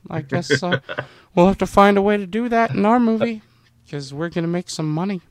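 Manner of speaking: high-pitched put-on voice; a comic, high impersonation of the film director; George Lucas. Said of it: "That's my George Lucas impersonation".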